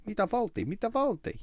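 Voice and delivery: put-on high, loud comic Italian accent; hammed-up Italian waiter voice; Manuel-style accent. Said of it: "a bit Manuel".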